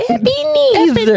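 put-on high, slow little kid saying the name. Mimicking the kid's name.

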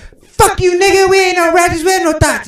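high, loud shouting; hammed-up angry girls yelling; mock angry shouting.

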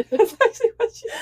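high, giggly protest; laughing. Real, not acted. Insisting to co-host the story was read as written, laughing. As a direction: squeal it through real laughter. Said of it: "why I'm laughing".

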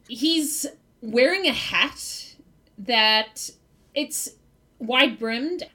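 ordinary talk

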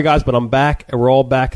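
ordinary talk

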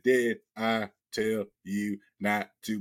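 slow, each word punched out; one word per punch. Retold or imitated. Taunting Angel with a word per punch. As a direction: deliver it like a combo, one word a hit. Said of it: "I did the same thing McLeod wanted to do".

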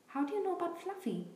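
high, startled gruff voice; startled Hagrid. A narrator's reading. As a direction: blurt it out, caught off guard. Hagrid startled, drops teapot.

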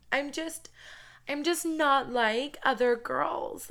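put-on high, drawn-out quirky-girl voice; mock a coy, quirky girl; hammed-up cutesy pick-me voice; Debbie Ryan. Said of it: "the Debbie Ryan right now".

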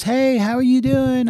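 put-on high-pitched cheery girl greeting; imitating the girl. Girl walking up to greet a stranger, imitated high. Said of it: "She goes".

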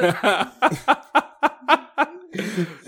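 high, loud cackling laugh; witch cackle. Acted. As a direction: cackle like an old hag. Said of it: "C'est mon rire de sorcière". (rire de sorcière aigu)

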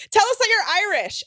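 high, taunting schoolgirl chant; mock high-pitched demand; popular girl. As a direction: imitate a popular girl goading a classmate. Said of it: "And she's like".

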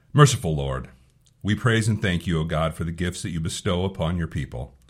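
ordinary talk, nothing marked

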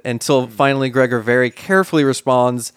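normal talking voice

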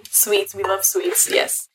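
ordinary delivery

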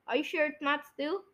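ordinary talk

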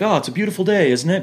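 high, sing-song cheery greeting; mock cheery greeting. Chirpy hello to a passing stranger. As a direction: play the overly cheerful stranger at the door.

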